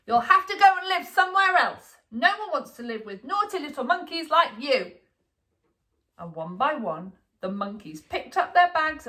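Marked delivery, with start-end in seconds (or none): 0.1-5.0 put-on high, loud child telling off; boy scolding. A narrator's reading. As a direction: lay down the law to your monkeys.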